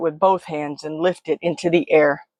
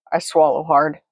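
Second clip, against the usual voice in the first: ordinary delivery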